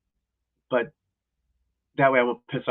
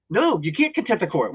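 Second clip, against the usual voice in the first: ordinary talk, nothing marked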